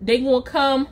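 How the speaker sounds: normal talking voice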